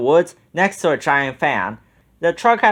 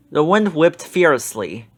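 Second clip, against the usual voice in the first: ordinary delivery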